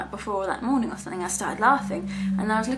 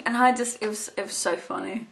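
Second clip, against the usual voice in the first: ordinary talk, nothing marked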